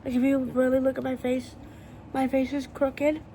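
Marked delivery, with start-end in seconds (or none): none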